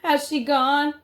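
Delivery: high loud cry of Grandmama; shouted call. Acted. Frightened boy shouting down to his grandmother, high loud call. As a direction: yell Grandmama up high to reach below. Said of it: "I shouted again".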